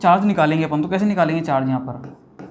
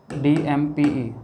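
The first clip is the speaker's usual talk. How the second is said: डीएमपीई drawn out letter by letter, very slowly; spelled out slowly; much slower than usual. Shown as a demonstration. Teacher spelling the ligand name for students, very slow. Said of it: "मैंने क्या बोला?".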